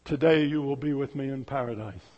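low, soft reassurance; gentle Jesus. Acted. Jesus answering the thief, low and tender. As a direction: say it quietly, like a promise.

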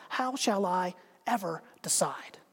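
slow soft whisper. Acted. Man on bridge whispers his dilemma to himself. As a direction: whisper it to yourself.

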